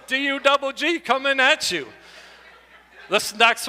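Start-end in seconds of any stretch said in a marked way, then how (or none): 0.1-1.9 lightly voiced rapper hype; a hint of a rap MC; mock rapper intro. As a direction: announce yourself like a rapper. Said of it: "I got my rap name ready".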